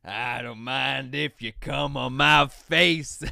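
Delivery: slow, loud, laughing quote; quoting the lyric laughing. Retold or imitated. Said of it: "And then he hits you with the".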